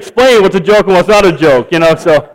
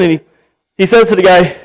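normal talking voice

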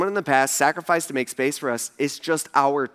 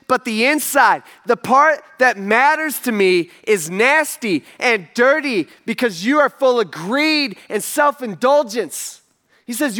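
loud, slow, high rebuke hurled at the crowd; preaching at full force; 6 semitones higher, 4 dB louder, slower than usual. Acted. Preacher voicing Jesus rebuking the religious leaders, loud and slow. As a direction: thunder it out like a prophet scolding.

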